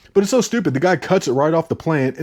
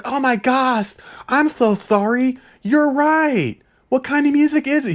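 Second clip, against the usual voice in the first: very high mock-apologetic girl voice; 12 semitones higher than usual. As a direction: squeak out a put-on girly apology. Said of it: "And she's like".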